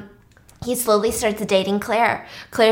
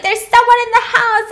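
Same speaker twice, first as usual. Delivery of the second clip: high, loud frightened cry; hammed-up terror, voice jumping up; mock panic; 13 semitones higher, 6 dB louder, faster than usual. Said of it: "she's having an anxiety attack".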